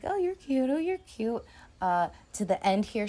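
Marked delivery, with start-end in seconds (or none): 0.0-0.7 higher, lightly cooing; mock flirty couple. Couple smiling at each other, mock flirty coo. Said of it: "just being like". 0.7-1.4 higher, lightly cooing echo; mock flirty couple. Lovers answering each other, mock flirty coo. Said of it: "just being like".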